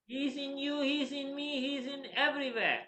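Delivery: high, sustained sing-song insistence; ardent declaration. Retold or imitated. Prahlad telling father where the Lord is, proclaiming. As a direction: proclaim each phrase on a lifted pitch.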